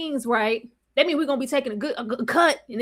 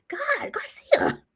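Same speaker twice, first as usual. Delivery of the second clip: shocked whisper; 12 semitones higher, 6 dB quieter than usual. Retold or imitated. Mock shocked reaction to Garcia.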